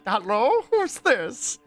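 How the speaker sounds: high, mock-pained phone answer; Apollo. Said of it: "like, pained while he's saying it".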